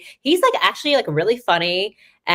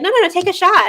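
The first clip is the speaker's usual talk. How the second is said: high, loud, playful insistence; won't take no, loud and high; insistent mock shout; 6 dB louder than usual. Teammates pressing reporter to shoot, loud high insistence.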